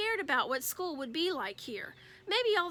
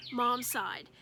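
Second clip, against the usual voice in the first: plain reading voice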